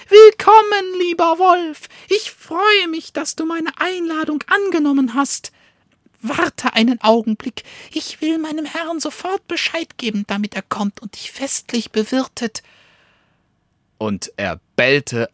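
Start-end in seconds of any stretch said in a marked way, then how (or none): 0.1-1.9 high, loud mocking welcome; calling out. Dog on the roof calls loudly to the wolf. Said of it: "lag auf einem Vordach und rief". 2.1-12.6 high, fast, mock-polite dog voice; gloating little dog. Little dog taunts the wolf from the roof, high and fast.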